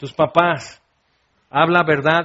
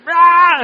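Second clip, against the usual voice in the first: loud, high raging roar; hammed-up scream of fury; Hulk; 11 semitones higher, 9 dB louder than usual. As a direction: roar as if turning into a monster. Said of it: "convirtiéndote en Hulk o Hulketa".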